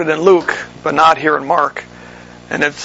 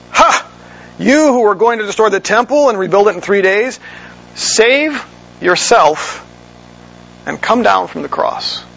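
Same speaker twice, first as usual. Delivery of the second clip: mocking jeer; 4 semitones higher than usual. Passersby taunting Jesus on the cross, higher mocking jeer. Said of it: "Look at what they say".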